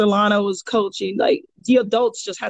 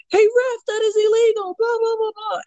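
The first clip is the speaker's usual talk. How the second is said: outraged crowd; 12 semitones higher than usual. Retold or imitated. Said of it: "everybody was like".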